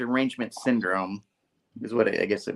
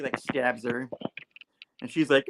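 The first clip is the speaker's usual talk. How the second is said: ordinary delivery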